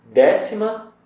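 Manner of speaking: DÉ-cima, stressed up high. Shown as a demonstration. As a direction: hit the first syllable of Décima. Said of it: "a rima está no E".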